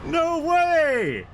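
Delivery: very high, loud disbelieving scream; excited screaming. Real, not acted. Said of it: "I'm sorry for yelling so loudly".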